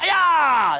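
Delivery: long, high martial arts shout; demonstration yell. Shown as a demonstration. Coach modelling the ninja strike yell, shouted. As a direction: let the yell rip to show them. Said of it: "Yell out".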